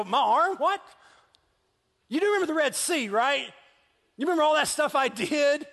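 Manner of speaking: high, loud and fast, mock indignant bragging; bragging God. God reminding Moses of the Red Sea, bragging. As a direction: show off like an offended champion. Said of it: "He's just, like, kinda bragging".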